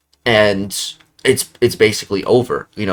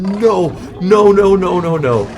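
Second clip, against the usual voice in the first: rising, louder wail of no; despairing no's; 9 semitones higher, 5 dB louder than usual. Retold or imitated. Gamer watching his fight go wrong, loud despairing no's. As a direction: groan no over and over as the round slips away.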